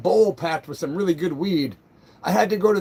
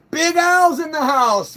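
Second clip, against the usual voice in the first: slow, higher, louder announcer call; hype announcer; 8 semitones higher, 5 dB louder, slower than usual. Real, not acted. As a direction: announce his arrival like a ring MC. Hyping a viewer's arrival.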